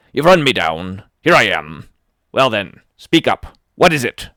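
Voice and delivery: loud, cornered bark; angry captain shout. Acted. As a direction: shout him down to speak up.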